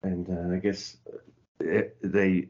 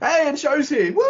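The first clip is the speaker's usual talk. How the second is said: high, loud cheer for the wrestler; excited shout; 6 dB louder than usual. Real, not acted. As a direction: call it out like a fan.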